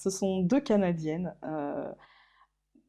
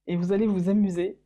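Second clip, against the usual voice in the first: ordinary delivery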